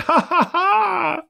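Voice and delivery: high, hearty laugh; joyous old man's laugh; splendid laugh. Acted. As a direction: laugh out, long out of practice. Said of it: "it was a splendid laugh".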